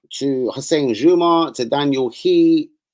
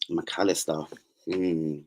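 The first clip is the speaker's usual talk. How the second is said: usual speaking voice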